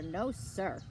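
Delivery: plain reading voice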